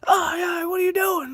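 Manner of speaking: high, loud mock scream at the kid; imitated scream. Stepdad running out screaming at the kid. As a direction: shriek it like a panicked stepdad.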